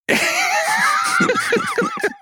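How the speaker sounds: high burst of laughter; real laugh. Real, not acted. Storytellers breaking the planned silence, real high-pitched laughing. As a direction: crack up helplessly.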